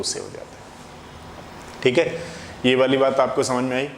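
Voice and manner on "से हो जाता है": whispered answer. Real, not acted. Answering own question, trailing off.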